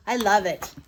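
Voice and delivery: usual speaking voice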